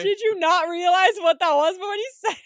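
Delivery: high, loud teasing disbelief; loud incredulity. Real, not acted. To co-host over accidental double meaning, loud incredulity. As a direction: burst out at a friend's slip.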